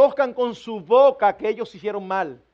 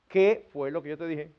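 calm insistence; 7 semitones lower, 6 dB quieter than usual. Acted. Parent calmly insists on the question.

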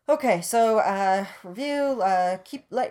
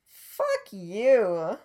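indignant outburst; 6 semitones higher, 7 dB louder, much slower than usual. Real, not acted. Outburst against the character.

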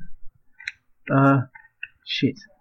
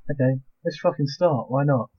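ordinary delivery

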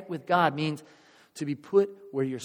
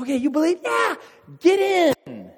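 high, loud shouted challenge; showman's dare; 13 semitones higher, 6 dB louder than usual. Acted. Tightrope walker daring a believer into the wheelbarrow, high shout. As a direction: build to a high shouted order.

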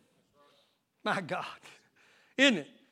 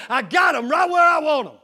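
loud, raised gloat building to a shout; 4 semitones higher, 8 dB louder than usual. Acted. Preacher voicing Satan boasting over distracted believers, gloating shout. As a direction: play Satan crowing over a catch, full voice.

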